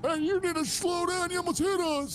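put-on high, cranky old man; old guy voice. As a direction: scold like a grumpy old man. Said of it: "we're doing old guy voices?".

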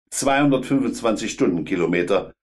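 usual speaking voice

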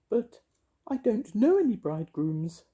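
very high protesting fairy; high-pitched little fairy. A narrator's reading. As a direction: object in a tiny piping voice. Fairy figure objects in story.

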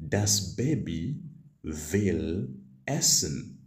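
slow, word-by-word German; slow model sentence. Shown as a demonstration. Teacher slowly modelling the German sentence for students. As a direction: lay each word out slowly. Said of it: "You simply say".

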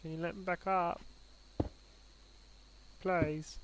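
put-on pleading pet in a light British accent. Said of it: "he's got a British accent".

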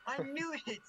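thrilled high burst; excited. Real, not acted. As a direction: blurt it out, delighted. Recognises the statue figure.

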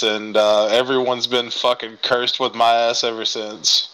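ordinary talk

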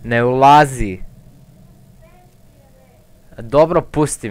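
loud panicked plea; scared shout. Real, not acted. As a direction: yell out in fright.

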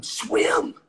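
a high, loud yell to a drowning man; yelling swim. Shown as a demonstration. Yelling at a drowning man to keep swimming. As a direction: bellow it across the water. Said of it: "You're gonna yell at him".

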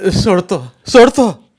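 high, loud panicked begging; pleading. A narrator's reading. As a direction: beg for your life. Beaten man begs for mercy. (घाबरून विनवणी)

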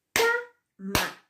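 Cama split in two, CA hit hard; stress on first syllable. Shown as a demonstration. Teacher showing the tonic syllable, Cama with a forceful CA. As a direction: punch the CA of Cama, let MA drop after a gap. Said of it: "tenía mayor fuerza de voz".

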